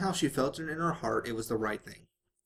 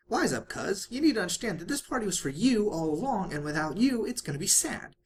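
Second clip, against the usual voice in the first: high, bouncy Pinkie Pie voice; 7 semitones higher than usual. A narrator's reading. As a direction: play the hyper pink pony, all giggles.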